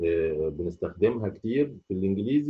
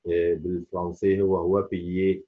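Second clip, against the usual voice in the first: usual speaking voice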